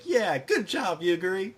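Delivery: high, chirpy mock praise; high-pitched imitation of a keen teammate; cheering young idol. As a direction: cheer her on in a small high voice. Lily cheers Yuguri on.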